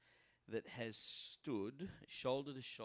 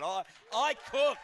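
I cooked said much higher and louder, cooked stretched out; second take of I cooked pitched up high and loud; louder, bigger retake; 10 semitones higher, 12 dB louder than usual. Shown as a demonstration. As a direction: pump up I cooked to fish for cheers. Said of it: "I need a bit more encouragement than that".

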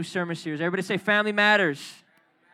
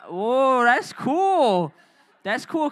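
high, loud delighted exclamation; wowed; 5 semitones higher, 5 dB louder, much slower than usual. Real, not acted. Youth director hearing the Korean word for father, wowed. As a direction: react amazed, pitch and volume jumping.

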